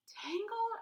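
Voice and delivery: high shocked yell. Acted. Girl yelling at the tangled bird news. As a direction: yelp it in disbelief. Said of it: "I yelled".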